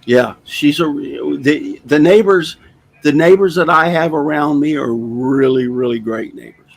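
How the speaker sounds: ordinary delivery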